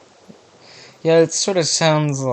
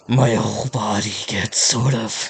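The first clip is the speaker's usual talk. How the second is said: slow, lightly menacing villain voice; a hint of an unhinged villain, slowed down; evil voice. Shown as a demonstration. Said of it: "I'm trying to practice my evil voice".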